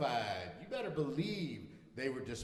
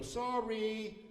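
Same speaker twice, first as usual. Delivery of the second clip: high, drawn-out mock apology; called-out apology; 11 semitones higher than usual. Golfer apologising to players ahead, high call. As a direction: call sorry across the course. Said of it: "we go".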